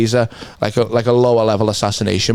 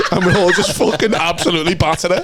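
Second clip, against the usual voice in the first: laughing; 7 semitones higher than usual. Real, not acted. Laughing at own joke.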